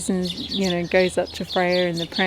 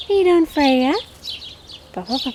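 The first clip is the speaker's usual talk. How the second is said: pet talk; 7 semitones higher, 5 dB louder, faster than usual. Real, not acted. Greeting the duck.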